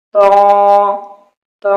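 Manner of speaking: تا as a heavy, rounded, nasal "tawn"; wrongly thickened. Shown as a demonstration. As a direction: thicken the long vowel of تا. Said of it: "بعض لوگ اس کو موٹا کر دیتے ہیں".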